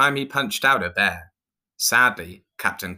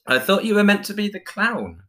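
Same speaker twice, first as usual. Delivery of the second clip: very high, louder mocking jibe; jibing; 14 semitones higher, 5 dB louder than usual. A narrator's reading. Pirate teases injured clown, high-pitched jibe. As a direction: taunt them in a raised, high voice. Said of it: "Jake jibed".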